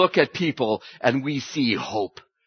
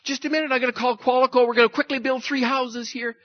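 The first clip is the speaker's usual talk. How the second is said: much higher, fast and eager; excited Peter; 11 semitones higher, 4 dB louder, faster than usual. Acted. Peter at the transfiguration, rushing to build houses, excited. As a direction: rattle it off in a keen rush.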